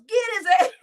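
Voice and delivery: mocking yell of the quote; quick mocking shout of "Get his ass". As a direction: yell it back, mocking the guy. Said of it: "Who the fuck yells".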